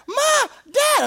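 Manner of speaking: put-on high loud baby shout of Da; little boy yelling for his dad; toddler calling out. Son calling from his crib, loud baby shout.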